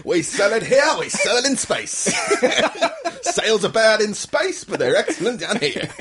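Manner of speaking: high, laughing salesman voice; laughing vendor patter. Pretend. Space popcorn vendor boasting, high and laughing. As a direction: giggle through the sales pitch.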